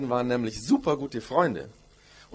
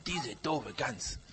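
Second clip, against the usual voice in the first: soft, slow muttered grudge; grumbling under breath; 6 dB quieter, much slower than usual. Acted. The two friends thinking angrily about each other, muttering. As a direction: mutter it to yourself. Both children sulking silently.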